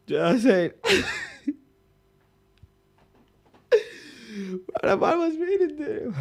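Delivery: high, squeaky laughing voice; helpless laughter breaking up the words; laughing while talking. Real, not acted. Laughing at his own memory.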